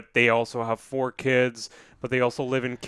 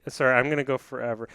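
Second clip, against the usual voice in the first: ordinary talk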